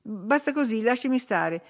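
petulant complaint. A narrator's reading. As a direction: fuss at the maid, voice lifted. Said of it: "aggiunse poi con petulanza".

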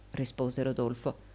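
usual speaking voice

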